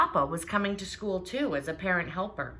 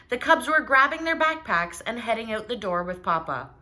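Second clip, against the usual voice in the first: ordinary delivery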